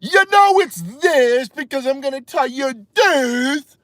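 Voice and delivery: high, slow, stretched mock explanation; mock know-it-all expert. Streamer imitating how experts always start, mock expert voice. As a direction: draw it out to ridicule the expert. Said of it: "they always gotta start with".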